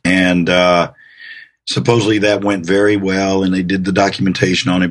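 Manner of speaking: ordinary talk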